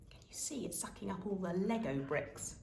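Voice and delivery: hushed conspiratorial whisper. A narrator's reading. Narrator asking listener, whispered with gasp. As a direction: breathe it to the listener.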